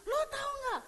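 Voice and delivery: high mock road-rage challenge; angry driver. Driver jumps out at the pastor, mock angry and high. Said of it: "turun langsung".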